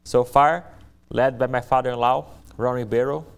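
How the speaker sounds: ordinary talk, nothing marked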